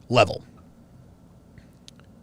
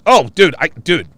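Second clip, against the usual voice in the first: ordinary talk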